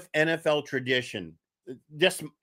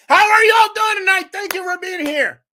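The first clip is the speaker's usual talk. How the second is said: high, loud host shout to the crowd; host welcoming viewers, loud and high; loud greeting; 12 semitones higher, 8 dB louder than usual. Real, not acted. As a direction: call out to the whole audience. Host greets live audience loudly.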